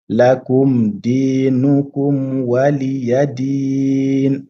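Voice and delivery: slow, drawn-out chanted recitation; Quranic recitation. Shown as a demonstration. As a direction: recite it slowly, stretching the vowels. Teacher recites verse as model.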